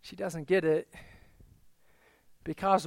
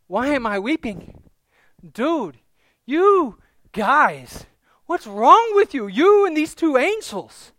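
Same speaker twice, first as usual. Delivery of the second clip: higher, incredulous outburst, growing louder; exasperated Mary; 5 semitones higher, 7 dB louder, slower than usual. Acted. Mary scolds the gardener and two angels, exasperated. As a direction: start raised and build to a loud protest. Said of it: "And so she says".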